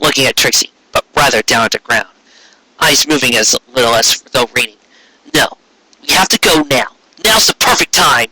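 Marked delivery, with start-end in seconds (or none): none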